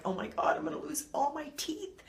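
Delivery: half-whispered rising panic; a retold moment of freaking out over the teeth; panicked. Telling viewers how she panicked about losing her teeth, mock panic. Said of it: "I was freaking out because I'm like".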